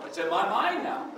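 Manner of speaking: said through real laughter; laughing. Real, not acted. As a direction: laugh while you say it. Laughing at doctor's dismissal.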